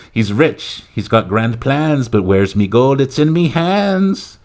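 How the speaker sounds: slow Irish leprechaun rhyme, rising high; hammed-up Irish brogue, stretched out; thick Irish accent. Leprechaun sizing up the president, mock Irish accent. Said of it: "in a thick Irish accent".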